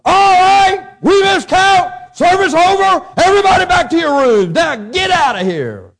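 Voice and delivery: very loud, high-pitched bellow, slowing on the last order; imitated guard shouting. Guard breaking up prison service for missed count, mock furious shouting.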